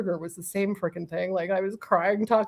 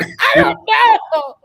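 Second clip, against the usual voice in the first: laughing shout; 11 dB louder, much slower than usual. Retold or imitated. Answering husband, overwhelmed and laughing.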